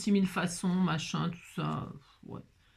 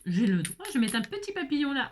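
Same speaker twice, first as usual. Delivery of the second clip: ordinary talk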